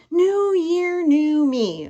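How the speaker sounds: high, drawn-out mock cheer; mocking slogan. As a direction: sneer at the New Year cliché. Ugh, don't do that.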